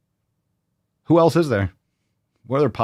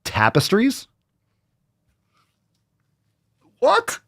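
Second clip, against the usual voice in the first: high, incredulous What; mock astonishment; 12 semitones higher, slower than usual. Host mock amazed that a store sells tapestries. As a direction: feign shock.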